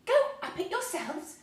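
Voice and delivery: high, loud horrified question; in horror. A narrator's reading. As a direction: gasp it out, appalled. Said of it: "in horror".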